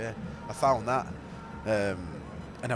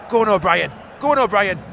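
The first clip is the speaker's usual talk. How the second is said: excited commentary; 8 semitones higher, 9 dB louder than usual. Real, not acted. Live excited match commentary.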